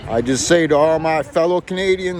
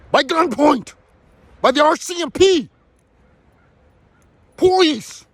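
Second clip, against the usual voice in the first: angry outburst; 7 semitones higher than usual. Real, not acted. Telling viewers about the RCMP taking his mother, angry outburst. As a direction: spit out the words in real fury. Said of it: "That's why I'm angry".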